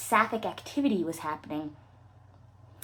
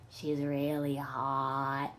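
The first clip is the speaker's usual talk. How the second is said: dreamy swoon; 8 semitones lower, 5 dB quieter, much slower than usual. Acted.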